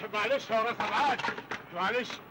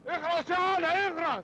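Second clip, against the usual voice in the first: angry shut up; 7 semitones higher than usual. Acted. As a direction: bark it slowly to shut him up. Uncle silences the boy.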